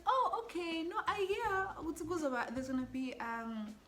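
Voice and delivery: high-pitched, polite mom voice; put-on civil tone, pitched well up; civil mother. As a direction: imitate a mother being suddenly agreeable. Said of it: "Is this woman being civil".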